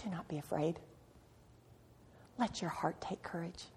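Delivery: put-on God whispering comfort to his frightened child; hushed reassurance. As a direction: breathe it like a tender promise. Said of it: "he says things like".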